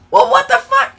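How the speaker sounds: high-pitched, startled disbelief; incredulous outburst. Real, not acted. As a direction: blurt it out high, totally baffled. Baffled by a viewer's message.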